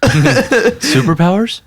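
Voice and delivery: squeaky, drawn-out high question; high mock question. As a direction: stretch it up high, laughing. Joking about cow prod effects.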